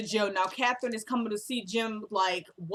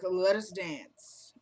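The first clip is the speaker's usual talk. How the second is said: slow, drawn-out invitation; 3 semitones lower, much slower than usual. Retold or imitated. He finally asks her to dance.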